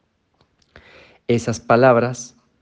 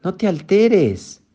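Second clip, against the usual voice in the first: mock scolding; 9 semitones higher, 4 dB louder, slower than usual. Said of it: "no pierdas la tranquilidad".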